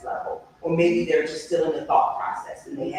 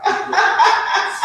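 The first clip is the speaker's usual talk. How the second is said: high, loud laugh breaking out; sudden bright burst of laughter; 8 dB louder than usual. Real, not acted. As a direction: laugh out loud, caught off guard. Laughs after apologizing to viewers.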